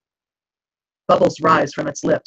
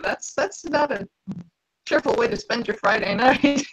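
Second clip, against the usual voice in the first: wry aside; 8 semitones higher than usual. Retold or imitated. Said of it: "I'm like".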